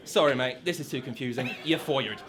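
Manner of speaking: mock deep boss voice firing him; low, deadpan boss. Boss firing the decorator, low and deadpan. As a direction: drop low and dry for "You're fired". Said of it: "Boy, I'm saying toilet".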